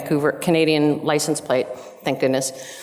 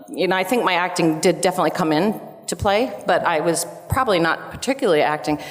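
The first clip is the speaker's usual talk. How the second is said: usual speaking voice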